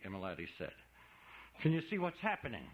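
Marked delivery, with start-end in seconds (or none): 1.6-2.7 breathless demand. Acted. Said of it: "he demanded breathlessly".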